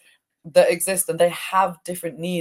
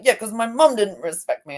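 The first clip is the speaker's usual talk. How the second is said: higher, wounded voice of a slighted part; the angry part answering her, higher and hurt; hurt inner part; 7 semitones higher than usual. Acted. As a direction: speak as the part that feels disrespected. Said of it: "I got really into character".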